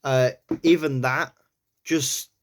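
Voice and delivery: normal talking voice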